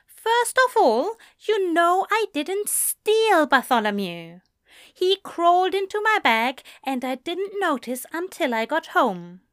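high, fast, fussy angel voice; Aziraphale voice. Acted.

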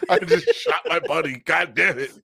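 high, loud mock distress; mock distraught. Retelling the shooting scene, mock distraught wail. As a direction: wail it like a shocked man. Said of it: "He's like, all distraught".

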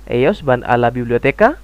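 "Ellos van a la biblioteca" as a question, rising at the end; question intonation. Shown as a demonstration. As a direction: lift the last syllable of "biblioteca" as if asking. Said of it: "Seperti itu".

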